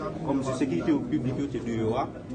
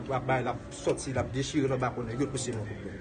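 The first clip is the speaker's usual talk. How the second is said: ordinary delivery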